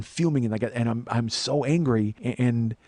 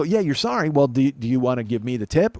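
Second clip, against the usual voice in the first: ordinary talk, nothing marked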